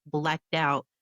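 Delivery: ordinary delivery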